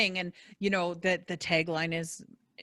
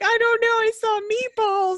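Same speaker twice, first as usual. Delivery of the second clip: tearful; 7 dB louder than usual. Retold or imitated. Wife retelling answering husband in the store, high teary whine. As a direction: play yourself bewildered and near tears. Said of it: "I'm like".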